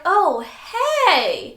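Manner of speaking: high, loud, sugary hello; mock the two-faced greeting; fake friendly greeting. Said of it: "they're like".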